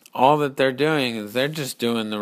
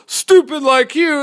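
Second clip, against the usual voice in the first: loud, high jeer; jeering insult; 13 semitones higher, 8 dB louder than usual. Acted. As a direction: shout the insult at full tilt. Acted insult among quarrelling men.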